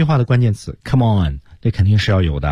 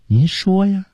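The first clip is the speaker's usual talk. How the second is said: "您说呀" lifted high and light, a coaxing rise; friendly rising ask; 10 semitones higher, slower than usual. Shown as a demonstration. Teacher demos a friend's coaxing question, rising. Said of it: "如果我们说好朋友这".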